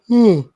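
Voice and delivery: a long, loud, rising yawn; big yawn. Real, not acted. Bored streamer yawns aloud at a dull slate. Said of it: "Excuse me for that yawn".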